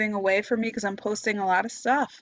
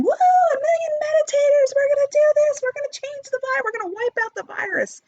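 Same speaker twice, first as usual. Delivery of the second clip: high, loud, giddy mock cheer; mocking the bandwagon meditators; 5 dB louder than usual. Mocking naive meditators who think they'll wipe out the virus, high giddy squeal. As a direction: parody the naive crowd, squealing with glee. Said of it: "how many people just fell on this bandwagon".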